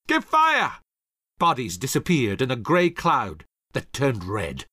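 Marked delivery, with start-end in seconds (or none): 0.1-0.8 high, loud command to fire; artillery captain shouting to his gunners; deadly order. A narrator's reading. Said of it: "Hamilton gave the deadly order".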